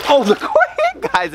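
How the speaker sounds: very high laughing squeal. Real, not acted. Owner squealing with laughter as fish battle. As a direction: shriek with laughter at the frenzy.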